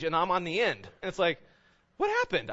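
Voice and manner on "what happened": very high, squeaky bewilderment; mock dismay. Preacher finding he is last in line by age, mock dismay. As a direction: squeal it in comic disbelief.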